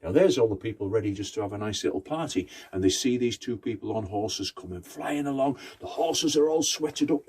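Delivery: usual speaking voice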